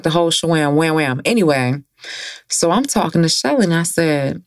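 ordinary talk, nothing marked

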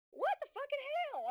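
retold "I can't believe this" outrage; shocked outburst. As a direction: react in high-pitched disbelief. Her own shocked reaction, retold.

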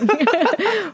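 high hummed bleep, falling off. Pretend. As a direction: censor yourself with a vocal beep. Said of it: "我自己消音".

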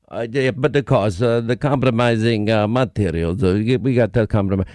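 put-on low, heavy Russian accent witness; deep Russian-accented witness, hesitant. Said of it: "[name], your Russian accent".

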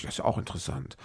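soft, unvoiced dry sarcasm; sarcastic aside. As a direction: say it quietly, dripping with irony. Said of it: "Sag ich". (trocken-ironisch, geflüstert)

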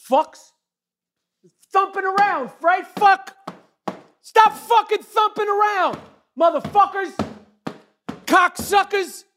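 furious yelling at upstairs neighbours. Real, not acted. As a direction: yell at the people thumping upstairs.

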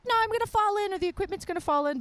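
high, fast mock worrying voice; mock worried Bree. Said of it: "Bree was always like".

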